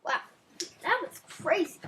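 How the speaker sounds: ordinary delivery, nothing marked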